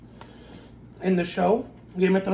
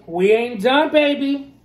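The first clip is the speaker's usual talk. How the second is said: higher, louder hype shout; excited; 7 semitones higher, 9 dB louder than usual. Real, not acted. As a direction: pump it up louder. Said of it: "hype repeat louder".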